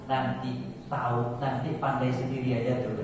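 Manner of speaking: quiet, calm fatherly reply. Retold or imitated. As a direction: answer gently, like a wise father. Father's calm answer quoted.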